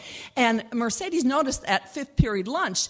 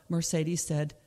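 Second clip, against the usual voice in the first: ordinary delivery, nothing marked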